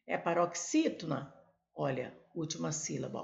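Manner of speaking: normal talking voice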